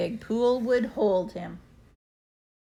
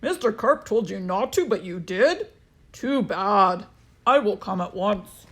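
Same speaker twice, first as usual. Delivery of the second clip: policeman; 3 dB louder than usual. A narrator's reading. Policeman answers the boy.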